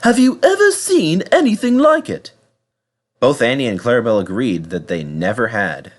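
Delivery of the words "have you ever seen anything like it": Thomas the tank engine. Acted. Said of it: "said Thomas".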